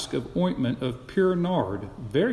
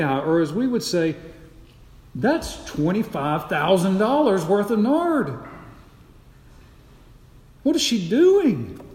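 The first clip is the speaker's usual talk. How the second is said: shocked dinner guests; 5 semitones higher than usual. Acted. Guests at the supper gasp at the wasted nard, shocked murmurs building. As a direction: gossip in disbelief, rising to outrage. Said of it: "the whispers start to begin".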